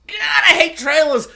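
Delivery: high, loud burst of frustration; exasperated outburst. Real, not acted. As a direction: groan it out loud, fed up.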